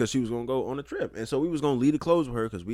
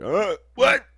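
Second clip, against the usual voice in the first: higher, louder mock startled yelp; startled awake; 12 semitones higher, 9 dB louder than usual. Patient woken at night by a nurse, mock startled. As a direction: act out jolting awake in alarm. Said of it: "not scared for my fucking life".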